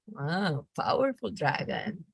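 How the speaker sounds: ordinary delivery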